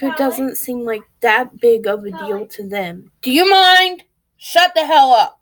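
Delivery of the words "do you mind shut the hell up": real angry shout. Real, not acted. Mother losing her temper at her noisy kids, shouting. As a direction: snap and yell to shut them up. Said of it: "lash out like I just did".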